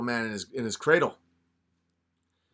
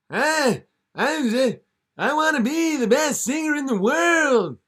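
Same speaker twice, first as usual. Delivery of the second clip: high, loud yelling [name]; hammed-up high [name] shout; whiny [name]; 13 semitones higher, 5 dB louder, slower than usual. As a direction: shout it like an eager young [name]. [name] begs the devil.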